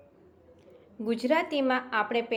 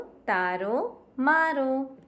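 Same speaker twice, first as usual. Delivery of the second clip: slow તારો, then મારો higher and louder; slow rhyme demo; 5 semitones higher, 4 dB louder, much slower than usual. Shown as a demonstration. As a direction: draw out the rhyme for the class. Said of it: "સરખો ઉચ્ચાર થયો".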